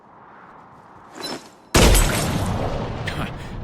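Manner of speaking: loud, open-throated victory laugh; triumphant laugh. Acted. Garrus gloating over his perfect shot, loud laugh. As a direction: laugh hard at your own winning shot. Bottle shooting contest, boastful win.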